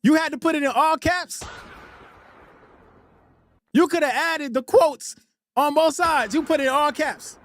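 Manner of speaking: high-pitched, exasperated questioning; incredulous protest. Real, not acted. Streamer scolding a chatter over all caps, incredulous. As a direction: pitch it up in disbelief.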